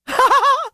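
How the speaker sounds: high, loud burst of real laughter. Real, not acted. Streamer cracking up at the comparison, loud high laugh.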